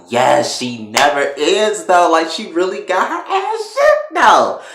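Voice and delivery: loud, climbing to a high yell; hyped reaction. Real, not acted. Viewer cheering how shook Veronica is, loudly hyped. Own excited reaction to the scene.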